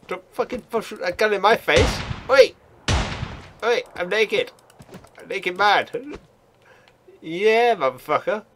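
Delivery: high, loud panicky yelling; heated gaming shout. Real, not acted. Naked gamer brawling an armed player, high frantic shouting. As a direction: shout it in a frantic fist fight.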